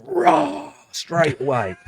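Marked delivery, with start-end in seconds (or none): 0.0-0.7 sudden high roar; retold angry roar; mock roar. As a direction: burst out roaring like an angry man at the door. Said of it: "he's like".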